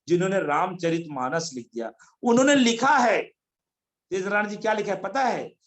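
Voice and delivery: normal talking voice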